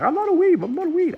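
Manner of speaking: very high, poorly imitated accent; Connor's accent. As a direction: attempt his accent in a squeaky mock voice. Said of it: "I can't do his accent".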